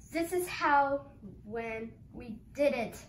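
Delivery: ordinary delivery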